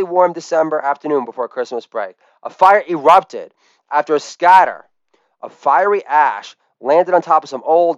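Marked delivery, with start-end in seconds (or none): none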